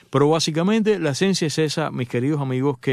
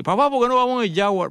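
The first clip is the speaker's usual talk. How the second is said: put-on higher whiny kid voice; teen son begging; 8 semitones higher than usual. Son asks dad for the Jaguar, whiny higher begging. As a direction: beg like a teenager who wants the sports car. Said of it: "y te dice".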